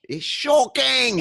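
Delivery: high, loud, stretched comic English accent; hammed-up English outcry, vowels drawn out. Shown as a demonstration. Host showing the guest how, exaggerated English accent. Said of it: "your best English accent".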